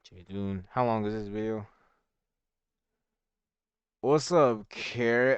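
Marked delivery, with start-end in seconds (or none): 4.0-5.4 excited greeting. Real, not acted. Greeting a raider on stream.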